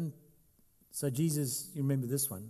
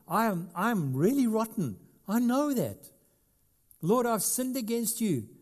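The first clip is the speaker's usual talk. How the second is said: higher, louder anguished confession; guilty sinner's cry; 8 semitones higher, 5 dB louder than usual. Acted. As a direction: cry out your guilt to the Lord.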